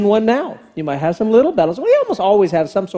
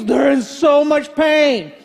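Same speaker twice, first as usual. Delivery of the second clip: hammed-up suffering; 7 semitones higher, slower than usual. Dad faking pain, laughing.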